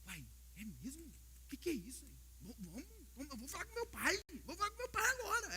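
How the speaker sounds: put-on a kid getting carried away with excitement; eager little boy. Pastor playing his son Caleb, lightly voiced eager child. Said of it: "Se você chega no menino, Caleb".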